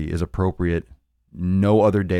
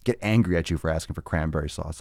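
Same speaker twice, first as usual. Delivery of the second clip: ordinary talk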